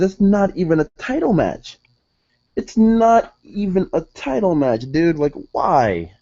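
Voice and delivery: high-pitched exasperated whine; pleading fan's gripe, pitch climbing high; whining complaint. Real, not acted. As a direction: whine in disbelief, dragging out the key words. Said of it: "my whining about that is done".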